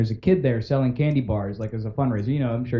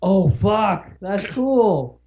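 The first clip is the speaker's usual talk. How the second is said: put-on higher, dazzled kid voice; awed kid; 7 semitones higher, much slower than usual. Fan kid reacts to meeting Bart, awed. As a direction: play the starstruck boy.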